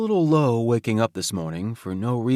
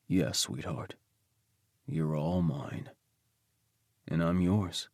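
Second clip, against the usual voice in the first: soft, slow and much quieter, soothing a lover; tender reassurance; 6 semitones lower, 8 dB quieter, slower than usual. Acted. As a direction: hush him gently, taking your time. Husband soothing his partner.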